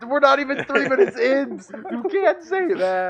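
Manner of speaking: high, loud, slowed disbelief; shocked protest. Real, not acted. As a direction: protest in mock outrage. Shocked protest at friend's joke.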